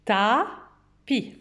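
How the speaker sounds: "Tapis" drawn out as taa, pause, pi; slow, separated "Ta-pis"; syllable by syllable. Shown as a demonstration. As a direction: lengthen the A in "Tapis", then place "pi" apart. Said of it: "on l'entend dans la première syllabe".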